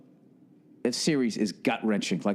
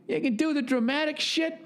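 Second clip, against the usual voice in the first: "You can do the dramatic shit" in a much higher put-on dad voice; dad impression; 13 semitones higher than usual. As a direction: hand the line over fully in dad's voice. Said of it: "That's what he said".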